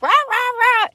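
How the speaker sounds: high, yappy imitated barking; mock barking. The girls barking at the old hag, high mock barks. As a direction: bark it out like the girls in the parlor. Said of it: "start barking at this woman".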